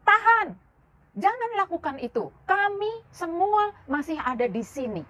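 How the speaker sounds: high, urgent cry to stop; shouted warning, pitched well up. Acted. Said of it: "Lalu dia berteriak".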